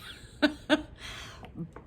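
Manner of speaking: a short high giggle; breathy laugh under the breath. Real, not acted. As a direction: giggle at your own joke. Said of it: "laughs at own joke".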